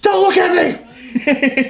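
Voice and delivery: high, slow shout broken by laughter; hammed-up ashamed shriek; mock wail. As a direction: cry out in shame, laughing through it. Cookie Monster caught eating dough.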